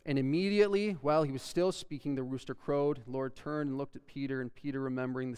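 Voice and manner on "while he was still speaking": usual speaking voice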